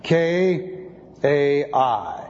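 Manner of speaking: K A I spelled slowly letter by letter; slow spelling of Greek letters. Shown as a demonstration.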